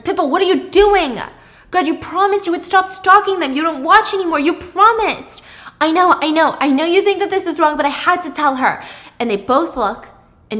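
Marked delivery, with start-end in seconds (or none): 0.0-5.4 loud, high, upset reproach; upset scolding. Acted. Thomas upset scolding Pippa. 5.8-8.8 defensive pleading. Acted. Pippa defending herself.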